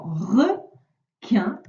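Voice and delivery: "requin" very slowly, one syllable at a time; teacher sounding out "requin"; split into syllables. Shown as a demonstration. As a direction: break "requin" in two with a clear pause between syllables. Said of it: "c'est dans la deuxième case".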